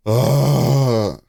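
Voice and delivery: long, drawn-out groan of dismay; frustrated groan. Real, not acted. Streamer upset at missing Splatfest, frustrated groan. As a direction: let out a sustained groan.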